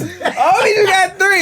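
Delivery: high and loud through laughter; laughing. Real, not acted. Laughing with friends at the joke, high and loud. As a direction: crack up mid-line.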